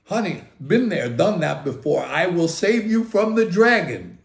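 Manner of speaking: mock knight who has done this before; cocky hero. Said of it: "And I'm like".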